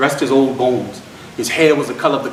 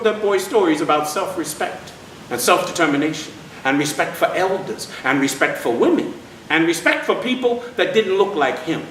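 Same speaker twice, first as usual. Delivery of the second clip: ordinary delivery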